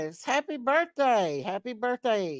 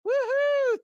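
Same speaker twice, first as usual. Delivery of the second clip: high, loud happy whoop; woohoo cheer; 3 dB louder than usual. Real, not acted. Host cheering a viewer's gift, high whoop.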